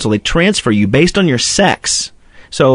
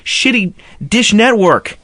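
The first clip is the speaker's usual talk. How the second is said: 1 higher mock outburst; 7 semitones higher than usual. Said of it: "I was like".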